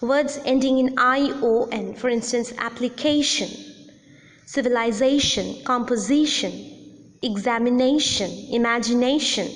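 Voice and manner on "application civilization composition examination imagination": appliCAtion, civiliZAtion, compoSItion, examiNAtion, imagiNAtion; stress before the suffix. Shown as a demonstration. Teacher shows the ION rule with application, civilization, composition, examination, imagination, next-to-last syllable stressed. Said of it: "words ending in I O N have the primary".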